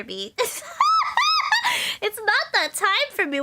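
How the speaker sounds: teary laugh, words shaking with giggles; laughing through tears. Real, not acted. Streamer laughing off crying in front of chat, teary laugh. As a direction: laugh it off while still crying.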